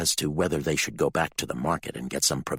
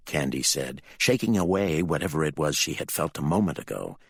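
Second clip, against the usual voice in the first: ordinary delivery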